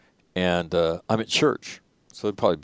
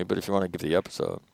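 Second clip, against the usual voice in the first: usual speaking voice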